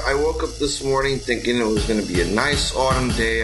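normal talking voice

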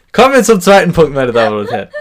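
loud, high mock show host; announcer voice. Mock host announces next point.

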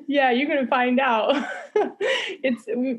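playful laughing warning to a first date. Real, not acted. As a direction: tease him with a laugh.

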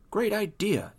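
high-pitched, delighted exclamation; excited dad; exclaiming. Acted. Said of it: "exclaimed Rita's dad".

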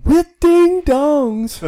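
very high, loud, slow squeal; silly squeal. Pretend. Mic joke, silly high voice.

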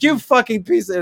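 high-pitched mock outburst; put-on indignant cursing; faked upset. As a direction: pretend to blow up, pitch jumping up. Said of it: "act upset".

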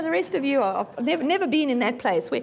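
usual speaking voice

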